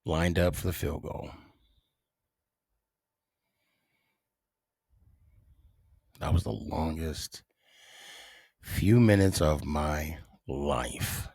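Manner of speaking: long suspenseful pauses between phrases; dramatic pause. Shown as a demonstration. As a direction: draw out the silence before each phrase. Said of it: "that's why I'm doing that dramatic pause".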